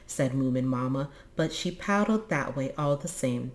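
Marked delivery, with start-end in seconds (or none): none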